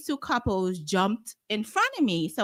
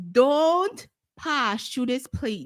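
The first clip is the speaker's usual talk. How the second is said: slow warning; 4 semitones higher, 4 dB louder, much slower than usual. Real, not acted. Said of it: "warning viewers".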